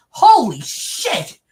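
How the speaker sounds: loud, high, drawn-out astonishment; amazed outburst. Retold or imitated. Reenacting her reaction to the church announcements, amazed outburst. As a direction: blurt it slowly with a laugh in it. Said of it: "This was me".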